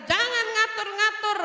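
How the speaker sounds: loud, high imitation of a scolding father. Father answering his child's complaint, angry scolding. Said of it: "Bapaknya ngomel, makin ngomel".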